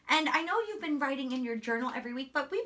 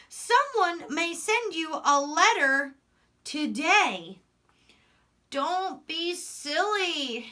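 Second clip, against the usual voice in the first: slow, lively read-aloud storytelling; reading to children; 4 dB louder, much slower than usual. A narrator's reading. Teacher reading Frog and Toad to her class, slow storytelling voice. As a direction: tell the story slowly, voicing Frog and Toad. Reads picture book aloud.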